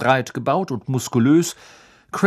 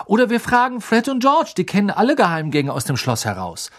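eager suggestion; 7 semitones higher, 4 dB louder than usual. A narrator's reading. Ron eagerly proposes another plan.

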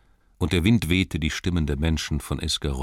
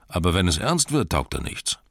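ordinary talk, nothing marked

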